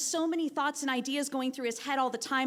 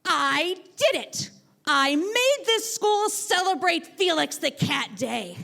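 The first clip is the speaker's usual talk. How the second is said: slow, louder, higher cry of victory; loud triumph; 5 semitones higher, 6 dB louder, slower than usual. Retold or imitated. Student proudly announcing his victory to the class, loud and slow. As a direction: announce the win to the whole room. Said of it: "he loudly said".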